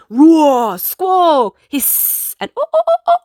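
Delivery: roar, squawk, a hiss, then very high hoots; animal noises. Acted. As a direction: sound off each animal in turn.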